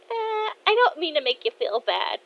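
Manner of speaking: high-pitched daughter voice; apologetic. Acted. Daughter sparing dad's feelings, apologetic. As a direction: backpedal in a high voice.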